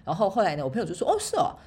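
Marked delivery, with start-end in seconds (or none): 1.1-1.5 a lightly voiced friend, pitched much higher; a light, high \"oh really\" as the friend. She imitates her friend's reply in a high voice.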